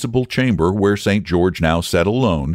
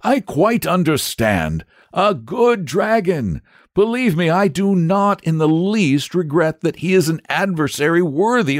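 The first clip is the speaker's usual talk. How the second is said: pleasant knight; 9 semitones higher than usual. Acted. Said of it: "smiling pleasantly".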